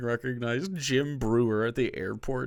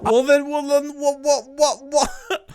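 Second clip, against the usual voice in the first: high, loud stuck-record stammer; mock sputtering; 13 semitones higher, 5 dB louder than usual. Host mocks comedian stuck in his story, sputtering. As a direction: mimic a man who can't finish his sentence. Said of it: "He can never fucking finish a sentence".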